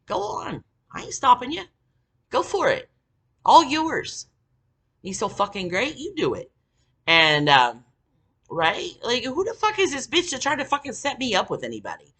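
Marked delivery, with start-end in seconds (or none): 0.1-6.5 high, mocking "go ahead" taunt; sarcastic dare. 9.0-12.1 indignant rant. Real, not acted. Indignant rant about Skanka.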